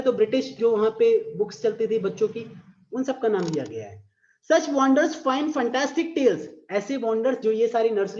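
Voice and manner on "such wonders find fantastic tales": slow, sing-song poem reading; drawn-out recital voice; poem recitation. Shown as a demonstration. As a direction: read it out like a verse for class.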